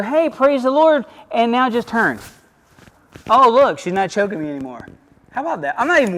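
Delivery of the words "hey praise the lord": joyful praise. Shown as a demonstration. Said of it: "I'm gonna go".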